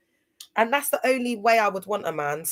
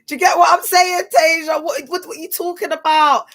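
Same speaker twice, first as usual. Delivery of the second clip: loud, high excited shout to a friend; excited call-out; 10 semitones higher, 9 dB louder than usual. Real, not acted. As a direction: shout it across at her, thrilled. Calls to Tasia.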